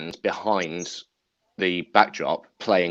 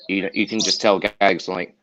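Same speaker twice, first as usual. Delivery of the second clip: ordinary talk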